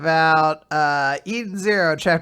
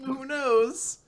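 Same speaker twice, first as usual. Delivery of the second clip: high, soft little voice; mock-coy murmur, pitched up; coy Weiss; 8 semitones higher, 5 dB quieter than usual. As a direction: toss it off in a small, high voice. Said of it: "Weiss is just like".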